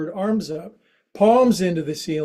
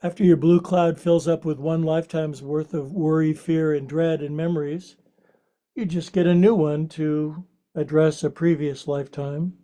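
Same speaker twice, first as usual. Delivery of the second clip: guided meditation; 2 semitones lower than usual. Acted. Guides restorative rest.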